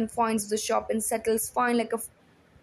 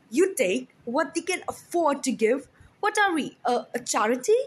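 sneering outrage; 3 semitones higher, 4 dB louder than usual. A narrator's reading. Husband mocking the seamstress's excuse, scornful and angry. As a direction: throw her words back at her in scorn.

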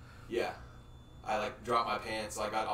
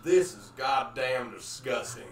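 mock disgust; 3 semitones higher, 9 dB louder, slower than usual. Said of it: "they get really upset".

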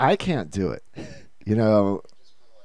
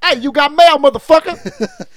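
much higher, louder yelled parody; mock shout; 11 dB louder than usual. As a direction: yell the line at him. Shouted parody of movie line.

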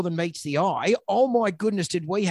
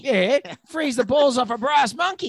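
put-on broad Australian accent; 5 semitones higher than usual. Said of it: "very Australian accents".

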